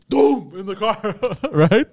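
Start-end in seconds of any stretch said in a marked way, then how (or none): 0.1-0.5 loud, higher thud sound; impact noise. Retold or imitated. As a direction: imitate a body slamming the car. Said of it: "it goes".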